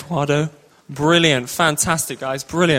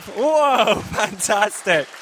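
higher excited whoa; cheering whoa; 3 semitones higher, much slower than usual. Real, not acted. Host cheers the actor's victory lap, excited. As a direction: cheer him on.

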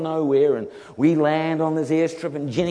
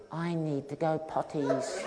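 put-on much softer, childlike pleading; plaintive little voice; 2 semitones lower, 10 dB quieter than usual. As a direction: ask like a small embarrassed child. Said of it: "this little plaintive voice".

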